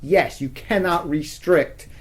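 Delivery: ordinary talk, nothing marked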